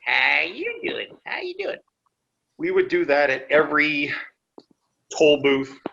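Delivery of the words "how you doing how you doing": high, drawn-out hillbilly greeting; sing-song hillbilly howdy. Shown as a demonstration. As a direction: greet them like a twangy hillbilly. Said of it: "you gotta put on the hillbilly voice".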